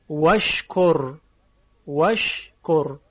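وشکر stretched out very slowly; slow demo. Shown as a demonstration. Teacher to students, demonstrating وشکر very slowly. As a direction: draw out وشکر syllable by syllable. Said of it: "وشکر رو واوش رو بذارید کنار".

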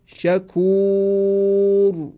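شکور drawn out very slowly, the long او leading into a heavy ر; heavy Quranic r. Shown as a demonstration. Teacher gives an example of the heavy r after long u. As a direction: recite شکور very slowly, ending on a thick ر.

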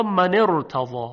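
loud model of من ارتضی, joined with a full ra; heavy ra. Shown as a demonstration. Teacher gives another two-word example, heavy ra. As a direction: link من to ارتضی by a short i, ra kept heavy.

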